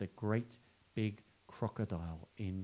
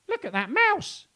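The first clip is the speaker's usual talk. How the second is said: very high, loud monkey chatter; squeaky monkey voice; 8 dB louder, slower than usual. Acted. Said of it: "chattered a monkey".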